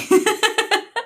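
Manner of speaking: high peal of laughter. Real, not acted. Amused at own accent habit, laughing.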